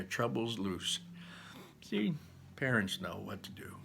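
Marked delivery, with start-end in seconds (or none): none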